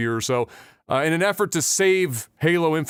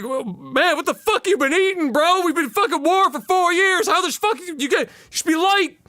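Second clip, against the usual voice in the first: high-pitched straining gripe; exasperated complaint; 12 semitones higher than usual. Acted. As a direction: moan at the man you're dragging. Joe complains about the heavy load.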